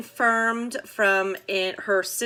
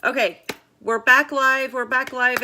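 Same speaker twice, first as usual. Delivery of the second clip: louder, upbeat we're back; excited announcement; 4 semitones higher, 6 dB louder than usual. Real, not acted. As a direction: cheerfully announce return. Stream restored.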